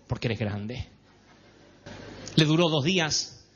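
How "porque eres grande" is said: calm mom. Retold or imitated. Mother answers child calmly.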